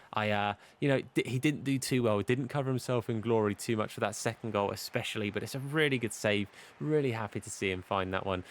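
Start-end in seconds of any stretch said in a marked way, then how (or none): none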